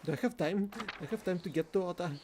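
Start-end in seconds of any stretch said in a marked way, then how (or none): none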